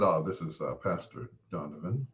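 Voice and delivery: a hint of an official caller; official pastor voice. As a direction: sound all business, like a pastor on the line. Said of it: "he's all official".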